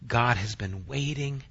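ordinary delivery

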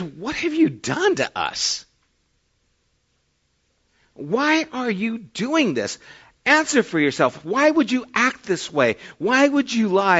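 a king's hurt indignation, drawn out; accusing questions. Retold or imitated. King confronts Abraham, accusing.

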